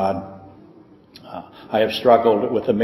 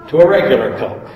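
laughing; 2 semitones higher, 6 dB louder than usual. Real, not acted. Reading funny letter aloud.

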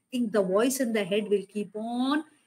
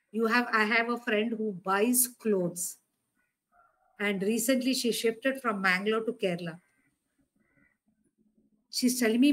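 usual speaking voice

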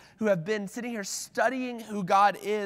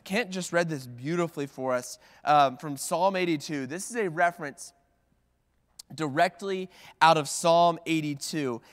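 ordinary talk, nothing marked

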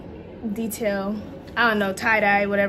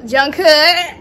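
excited; 3 semitones higher, 6 dB louder than usual. Real, not acted. Shouting favourite member's name.

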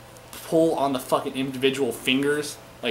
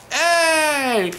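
very high loud shouted Hey; Mizdow copying Miz; 8 dB louder than usual. Retold or imitated. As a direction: yell it high, copying the boss exactly. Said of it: "He goes".

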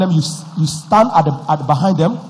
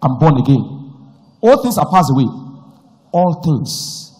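leading a prayer; 3 semitones lower than usual. Acted. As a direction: pray it aloud for them to echo.

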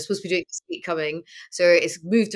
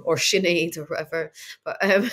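usual speaking voice

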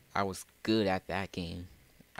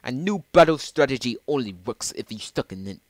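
louder put-on British accent; 5 semitones higher, 9 dB louder than usual. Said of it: "giving him a British accent".

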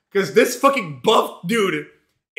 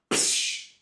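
punch sound effect; 9 dB quieter than usual. Retold or imitated. Mimics window breaking punch.

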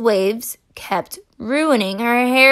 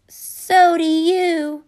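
slow voice; 7 semitones higher, 3 dB louder, much slower than usual. Acted. Mississippi's slow reply.

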